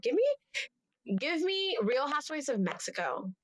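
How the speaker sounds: high, eager pleading; excited. Pretend. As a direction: beg Andy with mock urgency. Excited demand to Andy.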